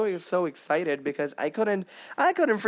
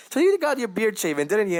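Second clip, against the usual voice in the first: higher, louder playful tease; Pieck teasing Yelena; 5 semitones higher, 4 dB louder than usual. Acted. Pieck teasing Yelena about his shaved beard, playful and higher. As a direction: lift it into a sly, playful jab.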